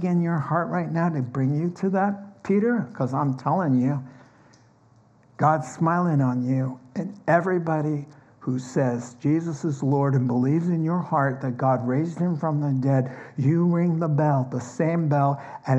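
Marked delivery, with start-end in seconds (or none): none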